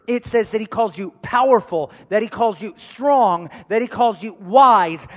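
rising preacher cadence; preaching. Acted. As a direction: preach it to the congregation. Preaching build-up.

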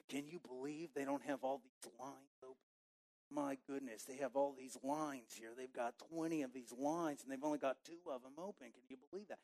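muttered grocery line grumble; muttering. Retold or imitated. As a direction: complain under your breath to the next person. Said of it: "saying it just loud enough".

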